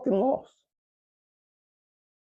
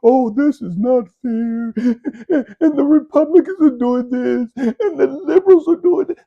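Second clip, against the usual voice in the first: mocking whiny complainer; 5 semitones higher, 5 dB louder than usual. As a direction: mimic someone moaning about politics. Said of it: "no voice that you could just sit back and talk about".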